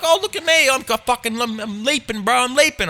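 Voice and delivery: high-pitched mock show-off voice; put-on high, needy bragging; attention-begging friend. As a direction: mimic a pal begging to be watched. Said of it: "it was sort of like".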